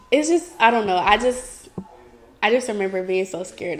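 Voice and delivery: ordinary delivery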